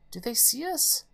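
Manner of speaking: high-pitched mother's voice; anxious question. Acted. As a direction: ask it high, unsure of being seen.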